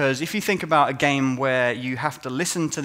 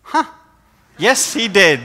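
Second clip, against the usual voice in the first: sarcastic reply; 7 semitones higher, 9 dB louder, slower than usual. Retold or imitated. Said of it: "And we're like".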